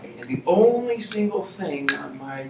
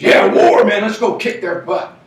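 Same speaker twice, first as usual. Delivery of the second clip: loud, high mock war whoop; gung-ho southerner; 7 semitones higher, 13 dB louder than usual. Mocking southerners who cheer for war, loud and high. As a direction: ham up a gung-ho guy itching for a fight. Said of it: "you go down south and everybody says".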